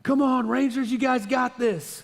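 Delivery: high, loud cheering yell, slowing at the end; imitating a dad bellowing from the bleachers; dad yelling from the stands. Dad cheers his son's team from the stands, mock yelling high and loud. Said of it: "you could hear him from a mile away".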